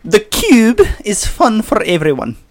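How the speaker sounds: Professor McGonagall. Acted. Co-host reviewing the cube as the professor, McGonagall. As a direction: deliver it high and measured as a schoolmistress. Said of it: "Take it away, Professor McGonagall".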